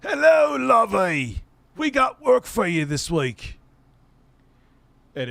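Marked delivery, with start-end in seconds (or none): 0.0-3.6 higher, louder British lady greeting; sweet English lady, higher and louder; imitating Joan's strong British accent. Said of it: "she had a very strong British accent".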